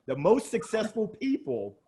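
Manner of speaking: normal talking voice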